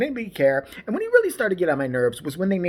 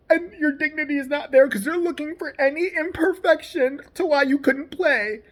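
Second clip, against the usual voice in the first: higher-pitched, tearful mock complaint; mock aggrieved complainer; 6 semitones higher than usual. Critic mimicking an athlete's slavery comparison, mock aggrieved. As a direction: imitate him whining, voice pushed up. Mocking retelling of his complaint.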